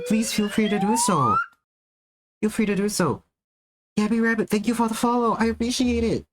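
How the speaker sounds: bubbly cute welcome to raiders; cute anime streamer. Acted. Raid welcome in higher cute voice.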